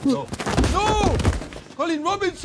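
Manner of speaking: high, loud delighted scream; screaming his name in excitement; excited shriek. Real, not acted. Thrilled seeing the video.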